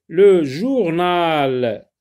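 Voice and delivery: loud, very slow drawn-out word; dictation style. Shown as a demonstration. Teacher announcing a new word to pupils, loud dictation style. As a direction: call out the new word, stretching it.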